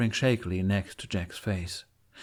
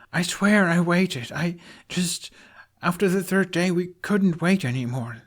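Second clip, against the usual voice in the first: earnest confession; 9 semitones higher, 4 dB louder than usual. A narrator's reading. Hiccup earnest confession to Jack.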